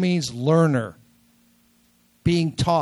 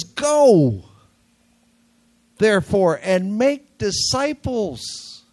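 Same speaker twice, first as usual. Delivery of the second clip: emphatic command; 4 semitones higher, 4 dB louder, slower than usual. Acted. Correcting the mock Jesus quote.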